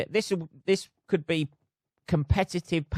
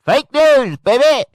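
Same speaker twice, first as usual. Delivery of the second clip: much higher and louder, a hammed-up hype shout; mock hype yell; 12 semitones higher, 15 dB louder, slower than usual. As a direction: bellow it like a showman. Mock hyped catchphrase.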